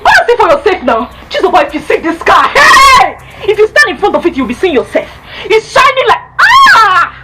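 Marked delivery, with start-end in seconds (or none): 1.3-7.1 awestruck excitement. Acted. Describing the car to sister, rising excitement. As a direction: build to an amazed shout. Raving about the shining car.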